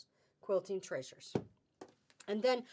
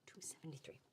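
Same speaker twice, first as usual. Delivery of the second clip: price repeated in a whisper; whispered correction; 7 semitones lower, 19 dB quieter, faster than usual. Real, not acted. Whispering the corrected price to viewers. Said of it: "I think I said two seventy-nine".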